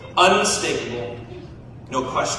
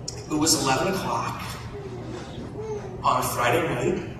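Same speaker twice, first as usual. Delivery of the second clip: suggestive; 5 semitones lower, 7 dB quieter than usual. Acted. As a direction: let the pauses hint at it. Suggestive pause about newlyweds.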